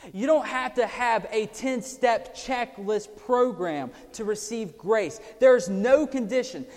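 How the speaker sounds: loud, high, insistent sermon; preaching. Real, not acted. Preacher insisting grace has no condition, loud and high. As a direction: drive the point home.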